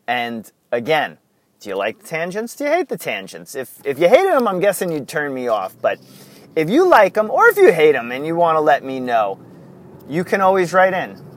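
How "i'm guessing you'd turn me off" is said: usual speaking voice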